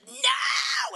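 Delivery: put-on high, loud toddler "no"; mock a screaming kid's protest; imitated tantrum yell. Preacher mimics a little child's freak-out, mock yelling. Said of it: "they're yelling and screaming".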